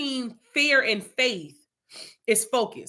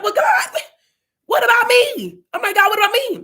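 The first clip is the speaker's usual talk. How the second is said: high, loud pleading to God; desperate plea; 12 semitones higher, 7 dB louder than usual. Retold or imitated. Pleading with God over everyone's new car, high and loud.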